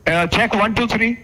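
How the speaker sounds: loud, clear mic check. Real, not acted. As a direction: count it out loudly for the room. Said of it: "Louder, please".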